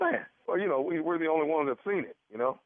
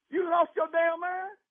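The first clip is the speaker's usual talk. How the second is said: high, slow outraged question; angry disbelief; 11 semitones higher, slower than usual. Real, not acted. Husband yelling at the prank doctor, high and outraged. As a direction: demand it in furious disbelief.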